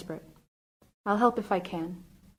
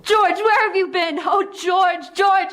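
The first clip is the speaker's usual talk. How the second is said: high, overjoyed calling; joyful cry; 6 semitones higher, slower than usual. Acted. Mary rushes to George, overjoyed. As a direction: call out in relief and joy.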